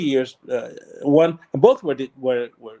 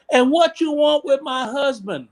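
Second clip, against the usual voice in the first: suspicious wife; 9 semitones higher than usual. Retold or imitated.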